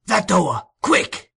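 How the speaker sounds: loud, sharp order; fierce urgent cry. A narrator's reading. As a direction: order them about fiercely. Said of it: "cried Sikes fiercely".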